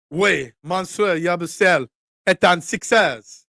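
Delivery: slow, hammed-up French accent in the style of James Carter; playful comic French accent like James Carter. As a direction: announce it in mock French, drawn out. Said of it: "my Rush Hour Three French voice".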